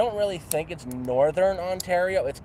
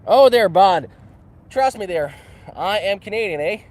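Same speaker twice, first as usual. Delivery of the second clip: put-on loud, higher-pitched Canadian hoser accent; hammed-up Canadian; 5 semitones higher, 10 dB louder than usual. Streamer insists to a doubting viewer they are Canadian, hammed-up Canadian accent. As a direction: lay the Canadian accent on thick.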